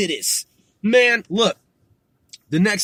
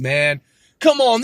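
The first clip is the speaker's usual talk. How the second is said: normal talking voice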